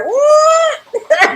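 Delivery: high shocked yelp, loud and long; shocked what. Retold or imitated. Retelling hearing a friend's news, a high shocked what. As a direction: squeak it out in disbelief. Shocked retort in retold chat.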